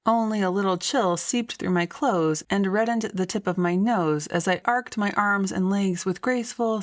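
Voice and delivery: ordinary delivery, nothing marked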